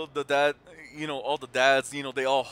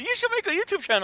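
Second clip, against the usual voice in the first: high daughter voice; 5 dB louder, faster than usual. Retold or imitated.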